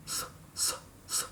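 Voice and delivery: "S" as a long voiceless hiss; unvoiced hiss. Shown as a demonstration. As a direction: let "s" hiss out with no voice. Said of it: "This is not needed".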